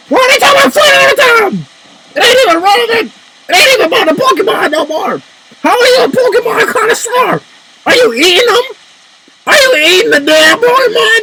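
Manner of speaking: exasperated rant. Real, not acted. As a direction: squeal it out in outraged disbelief. Ranting about food in Pokemon.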